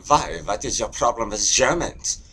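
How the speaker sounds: put-on high, indignant German-accented challenge. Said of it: "is that a German over there?".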